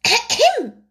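high self-important throat clearing; important ahem. A narrator's reading. The mouse demands silence with a pompous ahem. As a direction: clear the throat to command attention. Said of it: "with an important air".

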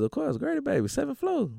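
high, excited hometown shout-out; proud boast. Real, not acted. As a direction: rep it with pride.

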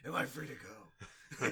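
slow, loud, laughing creep; creepy Batman voice. Acted. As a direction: drag it out sheepishly. Batman asks the host.

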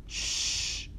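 long sharp "shh"; drawn-out shush. Acted. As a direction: hush a noisy group. Passengers shush the noisy teenagers.

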